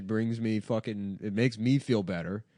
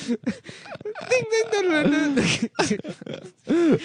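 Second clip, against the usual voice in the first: much higher sung-out ding dong noises; imitated crash dings; 6 dB louder, slower than usual. Comedian doing the crash sounds of Chinese Tony Soprano. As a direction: chime it out like a silly cartoon crash. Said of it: "crash noises".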